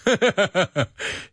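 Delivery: light high laugh; high giggle. Real, not acted. Laughs at Sharknado.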